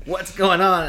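police demanding answers from the father, loud and alarmed. Retold or imitated. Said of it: "they're like".

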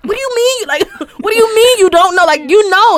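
retold scolding with a laugh breaking in; exasperated parent. Mother retelling how she challenges her son's answer, exasperated. I get so mad.

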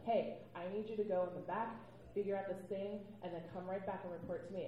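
ordinary delivery

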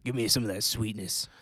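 low, greedy mock craving; low put-on voice. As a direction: ham up a low sweet-tooth voice. Playing along with LaCroix bit.

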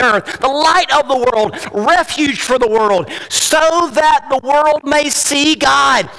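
preaching at full force. Acted. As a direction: thunder the scripture to the congregation. Climactic preaching.

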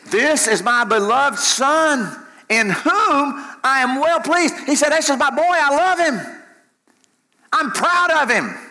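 high-pitched, full-force preacher's declaration; exultant proclamation. Acted. Voice from heaven claims his beloved son, exultant. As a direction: proclaim it like a proud father.

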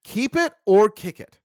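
loud, high, stretched-out announcer call; big, slow show-host call; game-show announcement. Real, not acted. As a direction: announce the game slowly and loudly. Host announces the game's prompt.

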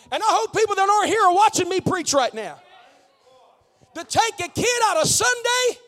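loud, high, shouted preaching; fired-up shouted sermon; full-force preaching. Real, not acted. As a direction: preach it out at full volume to the congregation.

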